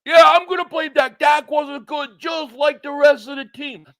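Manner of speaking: imitating Mark. Streamer mocking his friend defending the team, loud mock shout. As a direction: bellow it like an indignant Cowboys fan. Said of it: "He, he'll be like".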